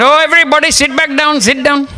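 high-pitched pastor impression; put-on high voice, ushering everyone to sit; pastor addressing congregation. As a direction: call out high, imitating the pastor. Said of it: "he says to the congregation".

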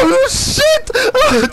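high shrill panicked yell; panicked shriek. Real, not acted. Panicked yelp in game. (cri de panique aigu)

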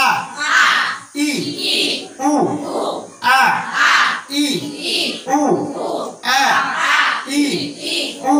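আ, ই, উ each cut short, never lengthened; quick short vowels. Shown as a demonstration. Teacher drills pupils on short vowels, each said quickly. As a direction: say আ, ই, উ as brief separate vowels. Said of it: "উচ্চারণটা এখন আমরা তাড়াতাড়ি try করি".

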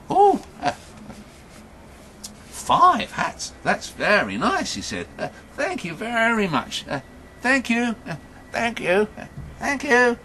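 put-on high-pitched, gushing old man; delighted old man. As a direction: repeat the thanks in a high old voice. Delighted old man thanks trader.

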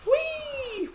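long high-pitched squeal of glee; celebratory whee. Real, not acted. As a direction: squeal "whee" on one very high held note. Celebrates finishing a quarter build.

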